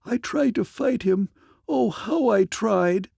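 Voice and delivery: high, anguished lament; near sobbing. A narrator's reading. Captive woman confessing her failed fight, breaking toward sobs. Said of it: "she began to sob uncontrollably".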